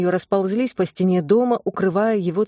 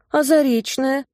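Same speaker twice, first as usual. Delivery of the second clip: plaintive; 7 semitones higher, 3 dB louder than usual. A narrator's reading. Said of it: "Тонечкин голос звучал жалобно".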